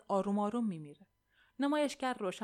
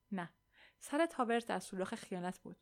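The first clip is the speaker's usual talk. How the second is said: usual speaking voice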